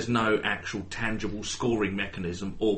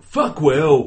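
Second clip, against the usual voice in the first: louder, higher shouted jeer; imitated shout; 4 semitones higher, 8 dB louder than usual. Teasing co-host about podcasters' catchphrase, imitated shout. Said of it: "they don't regularly shout".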